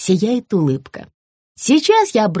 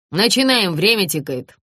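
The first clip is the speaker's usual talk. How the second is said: ordinary delivery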